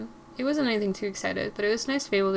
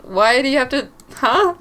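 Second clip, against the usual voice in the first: frustrated outburst; 3 semitones higher, 7 dB louder than usual. Acted. Player frustrated at in-game bullies.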